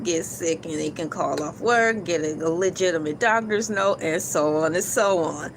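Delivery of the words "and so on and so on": ordinary talk, nothing marked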